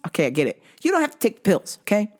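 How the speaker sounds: usual speaking voice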